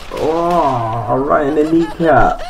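high, pained cry at the hit; a sudden high yelp as he's shot; yelp of surprise. Real, not acted. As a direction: shot in the knee, cries out high. Game character shot in knee.

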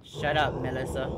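loud exasperated outburst; loud angry snap. Real, not acted. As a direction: snap it at her in a fright. Hissing at monster while hiding.

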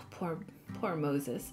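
breathy, hushed sigh of pity for Moses; sighing sadly over poor Moses; real pity. Real, not acted. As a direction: murmur poor Moses softly, heartfelt. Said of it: "it's so sad".

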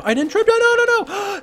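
high, loud frightened no's; panicked shriek. Real, not acted. Caught by masked man.

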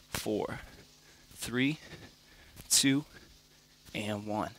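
quiet, strained counting mid push-up; counting workout reps. Real, not acted. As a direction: count reps under your breath while working. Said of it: "I'm gonna count it out for you too".

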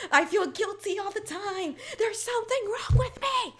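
high-pitched confession breaking into laughter; squealing through uncontrolled laughter; real helpless laughing. Real, not acted. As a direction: laugh helplessly while admitting something sad. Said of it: "I don't know why I'm laughing like this".

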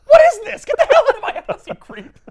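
put-on high, loud old-lady shriek; hammed-up Aunt May throwing him out; outraged Aunt May. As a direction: screech like an offended old aunt. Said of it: "Aunt May reacts".

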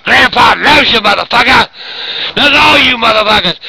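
loud, cussing declaration of love; yelled sign-off. Pretend. Grandpa telling his viewers he loves them, loud angry yell. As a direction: belt it out at the audience.

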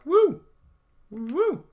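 high happy whoop; excited whoop. Real, not acted. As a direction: whoop with glee. Excited during combat.

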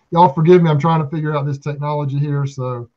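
normal talking voice

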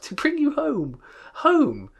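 put-on very high, loud gleeful child; thrilled small-girl voice; little girl. A narrator's reading. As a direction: squeal it like an overjoyed little sister. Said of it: "clapping her tiny hands and bending down to laugh".

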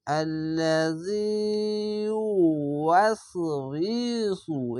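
slow chanted recitation, last word held long; teacher demonstrating tajweed; Quran recitation. Shown as a demonstration. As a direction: chant it and stretch the final নাস. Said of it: "মিং পড়তে হবে".

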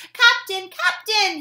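high, loud urgent call. Acted. Frog shouting for the captain at his door, urgent. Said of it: "he called".

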